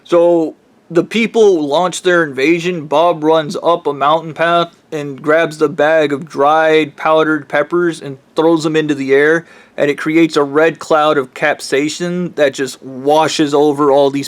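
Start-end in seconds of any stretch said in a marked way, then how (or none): none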